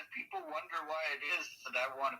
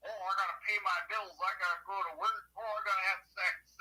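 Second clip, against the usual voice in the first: higher, slightly louder whine of complaint; mock other people's petty worries, pitched up; mocking imitation; 6 semitones higher, 4 dB louder than usual. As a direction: parody people fussing over trivial things. Mimics people with trivial concerns.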